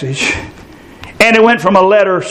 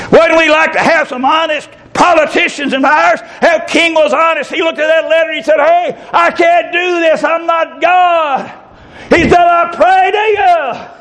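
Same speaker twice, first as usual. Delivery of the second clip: raised, fervent preacher's voice, slowing on key words; preaching at full force; 11 semitones higher than usual. Acted. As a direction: preach it out to the congregation. Preacher raising his voice.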